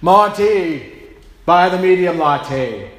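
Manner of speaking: loud, slow, godlike boom; mock divine voice booming an order about coffee; booming voice from heaven. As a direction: thunder it down from the heavens. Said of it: "this booming, thunderous voice from the heavens".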